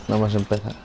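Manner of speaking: usual speaking voice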